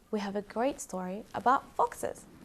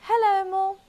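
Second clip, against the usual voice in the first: fox greeting; 12 semitones higher, 8 dB louder, faster than usual. Acted. Foxes greet mole.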